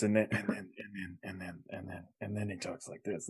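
a brooding actor repeating himself under his breath; repetitive actor impression.

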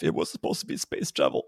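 whispered, low and soft complaint; mock whisper. Adult self in the meme whispering in despair. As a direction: breathe it out, defeated and hushed. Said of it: "Me at twenty-five".

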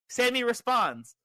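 normal talking voice

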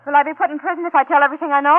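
high, loud panicked plea; frightened. Acted. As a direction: beg in terror, voice up. Frightened confession at arrest.

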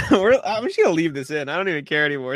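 ordinary talk

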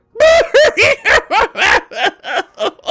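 high, loud wailing cry; hammed-up loud bawling. Trader sees her tent torn down, loud mock wailing. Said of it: "She just starts crying".

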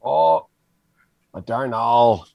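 put-on a light Scottish accent creeping into the dwarf's voice. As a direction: let a hint of Scottish slip in. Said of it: "you're slipping into a Scottish accent".

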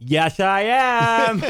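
high, slow cartoonish voice; goofy voice. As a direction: stretch it out, silly and high. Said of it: "you're the impression master".

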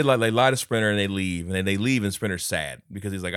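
usual speaking voice

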